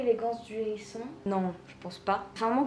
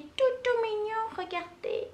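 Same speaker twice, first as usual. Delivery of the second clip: high-pitched gushing over something cute; cooing delight; 11 semitones higher, slower than usual. Real, not acted. Showing viewers a cute purse, cooing.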